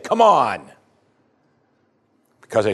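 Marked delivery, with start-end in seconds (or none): 0.0-0.7 exasperated appeal. Acted. Jesus chides doubters who saw his miracles, exasperated. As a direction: throw it out in mock exasperation.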